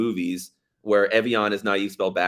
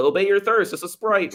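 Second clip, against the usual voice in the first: mock ad voice; 11 semitones higher than usual. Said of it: "better than being like".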